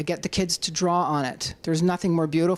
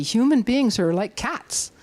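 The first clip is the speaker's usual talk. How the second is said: usual speaking voice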